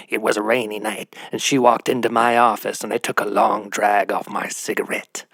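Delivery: hard-boiled detective narration; lightly voiced pulp detective; noir detective. Shown as a demonstration. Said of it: "in the style of noir".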